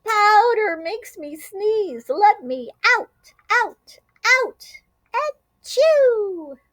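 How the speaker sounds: high, loud cry into a sneeze; sneezy shout. A narrator's reading. Glitzy groundhog shouting through powder sneezes. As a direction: shout then sneeze achoo. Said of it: "announced with a shout".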